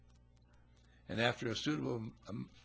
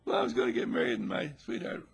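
upset bride; 12 semitones higher, 6 dB louder than usual. Acted. Jilted bride answering, upset.

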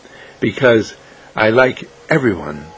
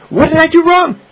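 very high, loud little voice; old lady voice; 5 dB louder than usual. Acted. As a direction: squeak it out, puzzled. Lady asking Irene.

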